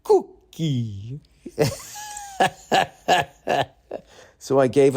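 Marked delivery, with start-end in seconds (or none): 0.0-1.2 put-on drawn-out little-kid word, lifting at the end; small child's drawn-out cooing; imitated toddler. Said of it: "He goes". 1.5-2.5 high staccato giggling; wheezy high laughter; real laugh. Real, not acted. Laughs at own imitation of son.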